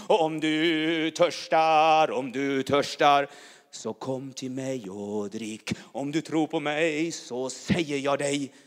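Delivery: loud fervent preaching, tongues mixed in; preaching at full force. Real, not acted. Preacher crying out to the church, loud and fervent. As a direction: proclaim it like fire over the congregation.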